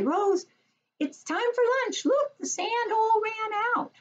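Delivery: put-on high, cheerful kid's voice; child calling out. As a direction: interrupt the teacher like an eager child. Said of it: "you can say".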